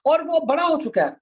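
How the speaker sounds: usual speaking voice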